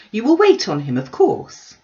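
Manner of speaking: mother insisting she's wronged; fretful mother objecting; indignant Mrs Bennet. A narrator's reading.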